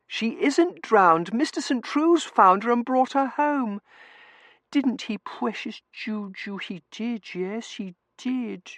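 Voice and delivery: high, slow cooing at the cat; baby talk to a pet. A narrator's reading. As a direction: gush over precious Juju. Tossie coos to her cat.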